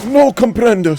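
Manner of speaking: fumbling "no comprendos" slowly; mock Spanish attempt; broken Spanish. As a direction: stumble through broken Spanish. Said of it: "Sounds like a fucking wizard spell".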